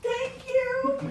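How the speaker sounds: thin, high mock thank-you; mock squeaky thanks; high grateful squeak. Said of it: "She's like".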